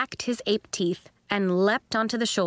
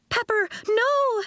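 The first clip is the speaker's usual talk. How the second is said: put-on much higher alarmed girl's voice; Nori's cry; 13 semitones higher than usual. A narrator's reading. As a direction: cry out to stop your friend. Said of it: "Nori cried".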